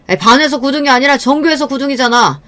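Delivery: high, loud outburst of exasperation; sudden angry yell. Acted. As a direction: yell it like a fed-up husband. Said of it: "왜 갑자기 소리를 버럭 지르고 그래요?".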